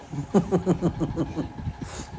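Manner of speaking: soft, high chuckling laugh; amused rippling giggle, kept soft; chuckle. Real, not acted. Parent amused by child's worry, chuckling.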